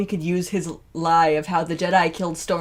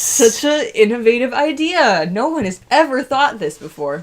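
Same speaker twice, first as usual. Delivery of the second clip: sarcastic; 5 semitones higher, 5 dB louder than usual. Pretend. Mocking the obvious plan.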